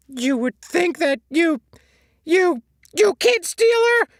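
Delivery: very high, loud and slow, spitting each word; slow, stinging, shrill comeback; spat back. A narrator's reading. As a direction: fling the accusation back at him. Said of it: "SpongeBob spat back".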